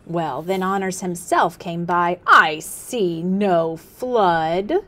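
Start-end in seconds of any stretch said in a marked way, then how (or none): 2.3-4.8 very slow, loud, drawn-out scorn; a mocking chief, drawing each word; scornful chief. Honors himself mocks Listener.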